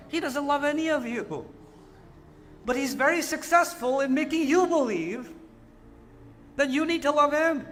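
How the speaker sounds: ordinary talk, nothing marked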